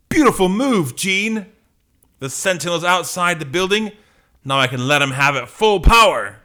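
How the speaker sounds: high, excited battle call; triumphant hero. Acted. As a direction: cheer your partner on and wind up to strike. Cyclops cheering in battle.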